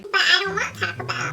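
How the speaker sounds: high, whiny mock of the friend, laughing; high, whining mock friend voice with a laugh; whiny friend imitation. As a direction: mimic her petulant whine. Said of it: "she was like".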